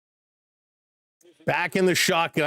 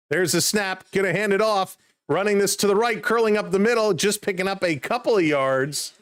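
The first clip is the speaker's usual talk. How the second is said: quick, raised sportscaster call of the run; live play-by-play; 4 semitones higher than usual. Acted. Streamer calling the Seattle run to viewers, lively play-by-play. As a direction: call the handoff as it happens.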